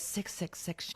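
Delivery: breathy whispered ominous reading; hushed whisper. Retold or imitated. Clerk whispering the cursed ticket number. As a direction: whisper it like a dark secret.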